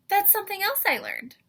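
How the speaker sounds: sudden delighted discovery; surprised gasp. Real, not acted.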